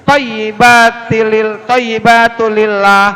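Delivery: slow "toyyibatulillah" with a held double y; tasydid on ya. Shown as a demonstration. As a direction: lean on the "yyi" in "toyyibatulillah". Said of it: "ya-nya ada tasdid". ("toyyibatulillah" dengan ya ditekan)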